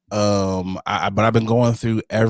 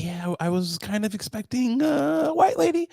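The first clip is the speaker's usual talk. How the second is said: interviewer voice; 10 semitones higher, 3 dB quieter than usual. Pretend. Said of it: "I was like".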